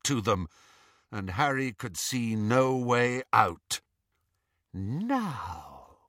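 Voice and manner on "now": low, soft menace; softly sinister. Acted. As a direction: purr it quietly. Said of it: "said Bellatrix softly".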